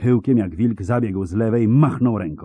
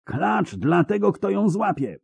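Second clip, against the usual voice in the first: high, ringing cry to the men; high-pitched rallying yell; shouted offer; 12 semitones higher than usual. A narrator's reading. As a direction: call it out to rally the riders. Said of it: "krzyknął Szkelen".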